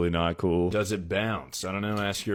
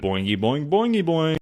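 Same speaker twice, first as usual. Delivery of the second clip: boingy noise leaping much higher on the repeat; playful boinging; 8 semitones higher, 4 dB louder than usual. Pretend. Streamer goofs with boing noises, springy and rising. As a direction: bounce the pitch up like a spring.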